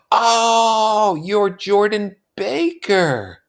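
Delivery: high, slow and drawn out; Daisy voice. A narrator's reading. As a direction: stretch it out in a high voice.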